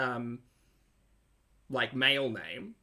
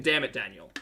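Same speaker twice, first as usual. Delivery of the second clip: ordinary talk, nothing marked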